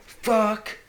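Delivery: high, loud shout of defiance; yelled defiance. Retold or imitated. Son screaming the curse at his father, high and loud. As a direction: hurl the words at him.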